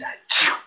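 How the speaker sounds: acted achoo sneeze; mock sneeze. Shown as a demonstration. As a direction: fake a sneeze. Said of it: "maybe you will do this".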